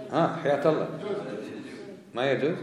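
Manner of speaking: normal talking voice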